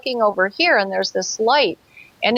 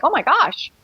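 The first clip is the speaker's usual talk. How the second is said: shocked gasp; 7 semitones higher than usual. Retold or imitated. Shocked as craft shoots up.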